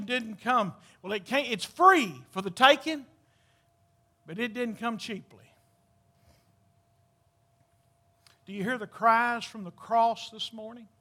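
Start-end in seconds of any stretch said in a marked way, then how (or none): 4.3-10.9 hushed preacher painting the cross; hushed, solemn. Acted. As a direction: draw them quietly to the foot of the cross. Hushed crucifixion meditation.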